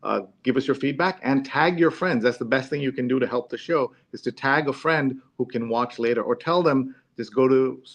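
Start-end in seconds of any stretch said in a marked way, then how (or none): none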